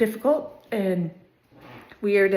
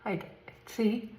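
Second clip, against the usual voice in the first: talking with fingers in mouth. Real, not acted. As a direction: speak around fingers while flossing. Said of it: "sorry for having my fingers in my mouth that whole time".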